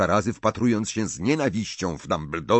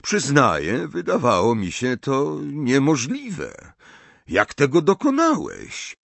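raised, unhurried, puzzled elder; higher-pitched, leisurely questioning; curious old headmaster; 6 semitones higher than usual. A narrator's reading. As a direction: marvel politely and ask for an explanation. Narrator voices Dumbledore.

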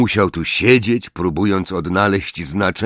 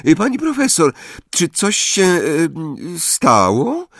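timid Parvati; 10 semitones higher than usual. A narrator's reading. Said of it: "nieśmiało".

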